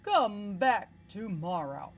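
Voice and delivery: slow, dismissive dragon; a big lazy dragon shooing her off; sleepy dragon voice. Acted. Dragon brushing off Elizabeth at his cave door, slow and bored.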